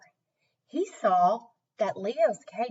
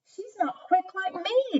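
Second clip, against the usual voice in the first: boastful sister; 10 semitones higher than usual. Acted. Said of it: "Debbie said".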